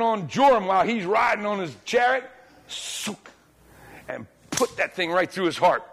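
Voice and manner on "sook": soft, voiceless "sook" swish; arrow whoosh. Retold or imitated. Preacher mimics Jehu's arrow in flight, hushed swish. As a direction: imitate an arrow zipping through the air.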